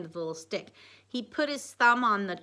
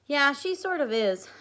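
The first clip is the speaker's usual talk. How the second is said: put-on higher, louder girl re-read; a bright girl's reply; 8 semitones higher, 7 dB louder than usual. A narrator's reading. Reread higher, louder as Amy.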